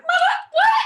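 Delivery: high, loud incredulous shriek; mock shock. Actress recounting her reaction to hearing that line, mock shock. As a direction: squawk "what" in exaggerated disbelief.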